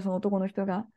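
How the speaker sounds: ordinary delivery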